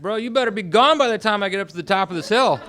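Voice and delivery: high, loud threat called uphill; shouted warning. Retold or imitated. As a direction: warn a stranger off at a shout. Retold warning shouted up a hill.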